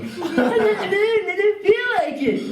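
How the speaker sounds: high, laughing mock refusal; mock high laughing excuse. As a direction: squeak the excuse through a laugh.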